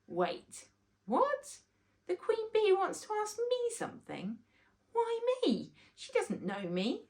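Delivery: put-on high, startled child's voice; a boy caught off guard and nervous; flustered. Rory hearing the Queen Bee wants him, high flustered child.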